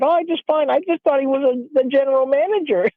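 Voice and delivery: a touch of an innocent grandma's voice; imitating grandmother. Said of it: "and she thought".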